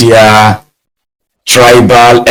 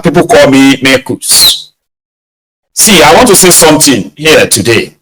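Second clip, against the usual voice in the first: normal talking voice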